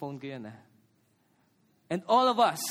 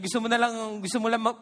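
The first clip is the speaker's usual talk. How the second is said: ordinary delivery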